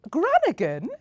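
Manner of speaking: loud high rising cry of surprise; startled outrage at grandma; shocked cry. A narrator's reading. Bella hit by Granigan's snowball, loud shocked cry. Snow dropped on Bella's head.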